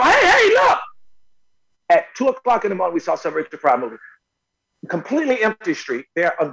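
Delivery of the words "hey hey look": sudden high, loud call of hey. Retold or imitated. Calling out to his friends on the street, a high loud hey. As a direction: shout out to grab the group's attention.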